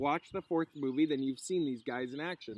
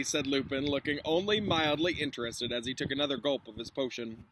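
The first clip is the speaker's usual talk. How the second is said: ordinary delivery, nothing marked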